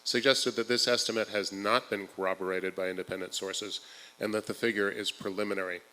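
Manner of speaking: measured, projected public-hearing delivery; formal statement read. Acted. Advocate reads the prepared statement to the forum, formal and projected.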